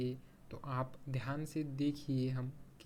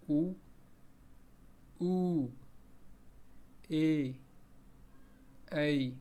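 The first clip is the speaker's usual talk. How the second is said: slow vowel recital; much slower than usual. Shown as a demonstration. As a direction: chant the vowels one by one for the class. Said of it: "एक बार इसका उच्चारण देखिए".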